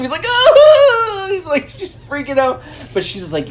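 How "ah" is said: very high, loud mock scream; imitated panicked wail; pained yelp. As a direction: shriek as if suddenly poked. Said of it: "pokes him".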